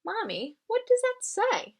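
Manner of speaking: put-on high, small child's voice; little girl. Little girl asking her mother about the carving, high and childlike. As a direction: ask like a curious toddler.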